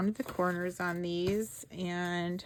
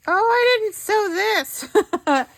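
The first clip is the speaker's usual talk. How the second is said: much higher and louder, a startled excited cry; real surprise at a mistake, pitched way up and loud; sudden surprise; 13 semitones higher, 12 dB louder, slower than usual. Real, not acted. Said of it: "I got a little excited there".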